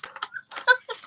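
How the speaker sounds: high squeaky giggle; high laugh. Real, not acted. Recalling the drive-through worker, a high giggle. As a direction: let a high giggle escape.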